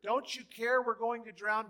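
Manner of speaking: high, loud cry of fear; panicked shout. Acted. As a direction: yell it over the storm.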